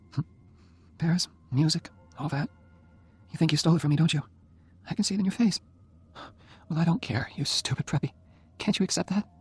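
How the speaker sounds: a hint of a frail but feisty girl; voiced young woman, teasing. A narrator's reading. Narrator voices dying Jenny.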